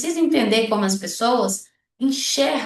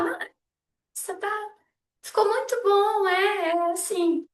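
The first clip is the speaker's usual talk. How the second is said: insincere reassurance; 10 semitones higher than usual. Acted. Friend avoiding the truth about an outfit, insincere reassurance. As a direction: stall in a raised voice while faking approval. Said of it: "Você tá vendo que não tá".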